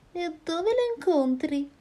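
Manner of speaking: high, otherworldly snake; strange snake voice. Acted. Snake asking the mouse where the meeting is, high and strange. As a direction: ask it like a curious creature, high up.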